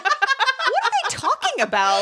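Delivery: high, slow, laughing disbelief; baffled. Retold or imitated. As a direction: squeak out your confusion. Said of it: "I was like".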